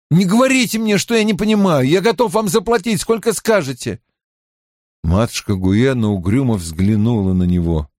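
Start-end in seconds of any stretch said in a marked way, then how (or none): 0.1-4.0 high-pitched, impatient cutting in; pressing, pitched-up character voice; insistent Mat. Acted. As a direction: urge her to name a price.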